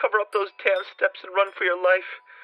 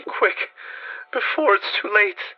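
resigned urging; 9 semitones higher, slower than usual. A narrator's reading. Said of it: "acquiring a softer quality, as of hopeless resignation".

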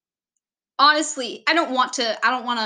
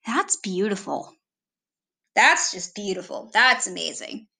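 low, unimpressed mock praise; sarcastic compliment in a lower voice; deadpan sarcasm; 4 semitones lower than usual. Shown as a demonstration. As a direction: say it dryly, pitched down. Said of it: "much sarcasm right there".

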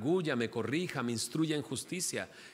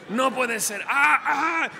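loud, high pained yell from the needle; retold scream; 11 semitones higher, 8 dB louder than usual. Father yelling in pain while his daughters watch, loud high yell. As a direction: cry out as the needle sticks. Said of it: "yo grito".

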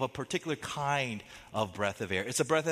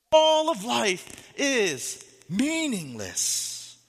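very slow, higher, louder lament; drawn out lament; 9 semitones higher, 6 dB louder, much slower than usual. Acted. Preacher reading the verse to his church as a slow lament. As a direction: stretch every word like a weary lament.

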